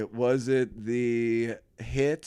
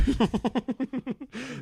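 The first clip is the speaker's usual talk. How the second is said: high-pitched giggling laugh; 13 semitones higher than usual. Real, not acted. Co-host cracking up at the gas station bet, high laugh.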